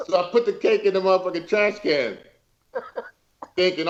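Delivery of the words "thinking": usual speaking voice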